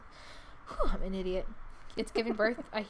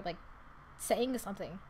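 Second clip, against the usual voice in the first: ordinary talk, nothing marked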